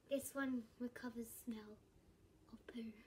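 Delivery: hushed whisper about the smell. Real, not acted. Kid whispering to viewers about a smelly squishy, hushed. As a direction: whisper it like a gross secret.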